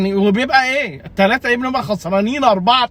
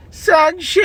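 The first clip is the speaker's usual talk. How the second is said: exclaimed name; 6 semitones higher, slower than usual. Real, not acted. Vlogger yelling his keeper's name in disbelief, exclaimed. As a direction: blurt the name out in exasperated disbelief.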